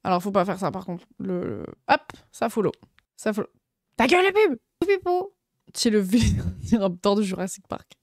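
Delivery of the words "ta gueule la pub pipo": much higher cartoon voice telling the ad off; mock squeaky yell. Streamer telling an ad to shut up in a silly high voice. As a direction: squawk at the ad in a silly voice. Yelling at ad.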